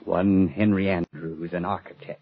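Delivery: slow dazed monotone. Real, not acted. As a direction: recite it as if hypnotized. Dazed man repeating the phrase.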